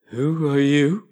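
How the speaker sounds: slow, drowsy caterpillar; languid sleepy voice. A narrator's reading. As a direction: lazily blow the question out. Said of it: "in a languid, sleepy voice".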